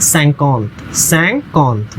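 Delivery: सैंकौंत said slowly with a nasal end; French cinquante. Shown as a demonstration. Teacher modelling French fifty slowly for students. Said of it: "fifty sounds like".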